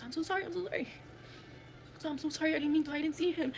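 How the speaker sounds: high, upset repeated apology; imitating a distraught driver; retold frantic apology. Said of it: "he goes".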